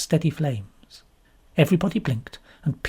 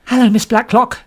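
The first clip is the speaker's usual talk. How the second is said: higher, louder cheerful hello; exclaimed greeting; 6 semitones higher, 6 dB louder than usual. A narrator's reading. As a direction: greet her brightly, beaming. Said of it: "she exclaimed".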